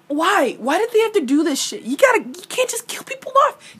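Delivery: high, pleading complaint; exasperated whine. Real, not acted. Fan complaining to writers about killing Elias, high whine.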